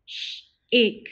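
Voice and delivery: Sh-ache split into onset and long vowel; segmented. Shown as a demonstration. Teacher sounding out Sh-ache for the class. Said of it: "Can you say shake?".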